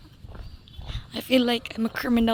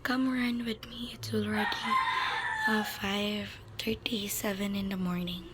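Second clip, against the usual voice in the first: whisper; 7 dB quieter than usual. Real, not acted. Vlogger whispering to viewers before dawn. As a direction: breathe the words out without voice. Early morning run, whispering.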